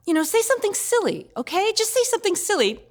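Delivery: lightly voiced big sister coaxing a kid; coaxing older sister. Sister coaching the distressed child.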